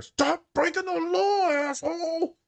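high, slow mock shout; mock yell. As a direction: yell it like a movie line. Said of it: "on Liar Liar".

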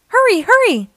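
high, loud calling out; urgent call. A narrator's reading. Firefighters call to the fire truck, urgent high shout. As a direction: call the crew to hurry. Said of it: "The firefighters call".

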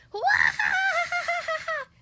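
very high, loud, drawn-out cackling roar; roaring laugh. Acted. Tickled dragon helpless on the ground, roaring laughter. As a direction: burst into a huge stretched belly laugh. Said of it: "roared the dragon".

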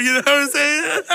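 high laughing, almost crying; cracking up mid-sentence. Real, not acted. Cracking up at own joke.

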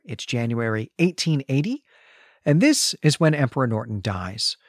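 ordinary delivery

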